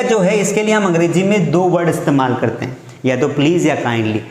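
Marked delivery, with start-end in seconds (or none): none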